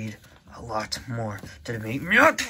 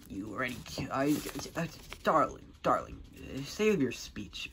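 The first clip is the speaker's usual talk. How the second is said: ordinary delivery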